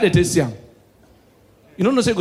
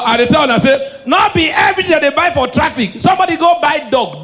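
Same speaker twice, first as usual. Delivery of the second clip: high shouting to crowd; 8 semitones higher than usual. Real, not acted. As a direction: call it out to the crowd.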